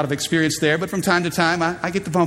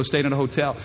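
usual speaking voice

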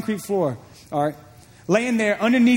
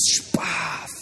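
a whispered slap noise effect; whispered smack for the backhand; slap sound; 4 dB quieter than usual. Retold or imitated. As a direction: breathe out a sharp smack sound.

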